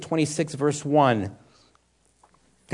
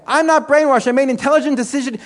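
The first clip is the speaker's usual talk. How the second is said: high, loud insistent comeback, then quicker; firm retort; 8 semitones higher, 5 dB louder than usual. Retold or imitated. Preacher answering a passerby on the street, loud and firm. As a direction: push back hard on the heckler. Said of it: "and I'm saying".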